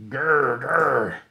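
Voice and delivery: growl. Acted. Alan scaring in jungle.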